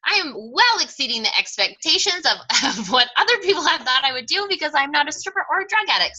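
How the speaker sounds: high, loud self-congratulation; mock cocky. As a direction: boast over the top, pitch leaping up. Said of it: "that was kind of my thing".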